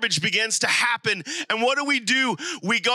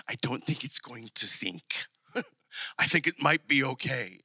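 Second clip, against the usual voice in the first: low, soft, amused understatement; hushed, lower-pitched comic reassurance; calm aside; 7 semitones lower, 6 dB quieter than usual. Acted. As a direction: say it softly with a small chuckle. Calm reader's aside after disciples' panic.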